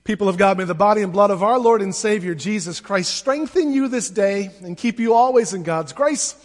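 normal talking voice

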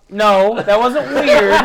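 laughing dismissal. Real, not acted. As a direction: scoff loudly while cracking up. Ridiculing the story while laughing.

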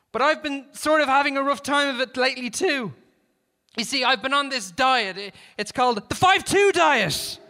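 loud, high call across a distance; shouted confession. A narrator's reading. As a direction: explain yourself at a shout. Negotiator shouts confession to gunman.

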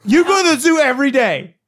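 loud, high-pitched comic accusation; mock-angry insistence. Real, not acted. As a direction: throw it back loudly, indignant. Mock argument with a friend.

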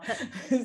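high, loud laugh breaking out; real amused laugh; burst of real laughter. Real, not acted. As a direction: laugh out at the memory. Laughs at own story.

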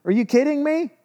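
high incredulous question; God scoffing at Moses' fear, voice high and incredulous; mock disbelief. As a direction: act amazed he doubts.

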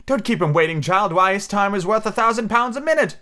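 high, chanted chorus of voices; sing-song crowd chanting together; high chorus of passengers chanting in unison. A narrator's reading.

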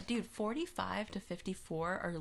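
usual speaking voice

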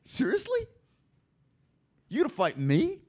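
lightly voiced, incredulous chuckle; amused disbelief. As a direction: play the Lord laughing off a puny threat. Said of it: "First of all, he laughs".

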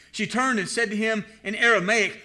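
ordinary talk

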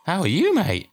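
high, chummy mock greeting; talking to a foot. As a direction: chirp it at the foot.

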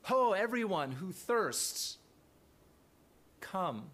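an urgent call of "Hey, ho"; impassioned cry. Shown as a demonstration. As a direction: plead it out with real emotion. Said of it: "trying to capture that sense".

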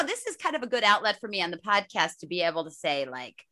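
ordinary delivery

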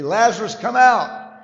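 high, full-force commanding shout; loud voice. Acted. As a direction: call it out as loud as you can, pitched high. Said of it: "He says in a loud voice".

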